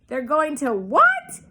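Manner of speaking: loud, shocked scream, slow. A narrator's reading. Pig screams at the sheep's news, loud and shocked. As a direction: Wilbur screams in disbelief. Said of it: "screamed Wilbur".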